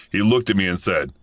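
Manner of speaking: ordinary delivery, nothing marked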